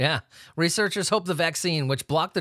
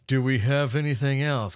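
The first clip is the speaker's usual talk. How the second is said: put-on voice. Shown as a demonstration. Asking for more news, repeated in a goofy voice. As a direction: Do we have anything else? in an odd put-on voice.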